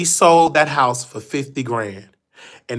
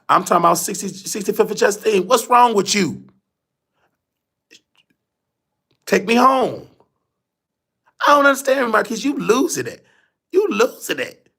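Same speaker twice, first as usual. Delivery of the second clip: put-on high-pitched old grandma, insisting on her old address; imitating indignant grandma; 7 semitones higher than usual. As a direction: play the confused grandmother scolding her grandson, voice pitched well up.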